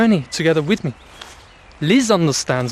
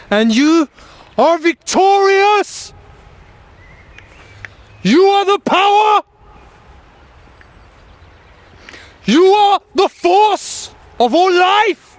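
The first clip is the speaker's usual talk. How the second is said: high, slow rallying oration building to a full shout; rally the crowd, rising into drawn-out triumphant shouts; fervent rally speech, swelling louder and higher; impassioned preaching; 8 semitones higher, 4 dB louder, slower than usual. Real, not acted.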